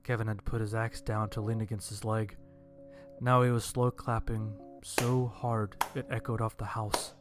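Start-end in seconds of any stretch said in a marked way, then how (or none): none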